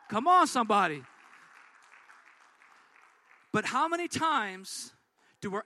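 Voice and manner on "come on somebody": much louder rousing call; preacher's call out. Real, not acted. Preacher rousing the church, loud and fervent. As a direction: call out to the congregation.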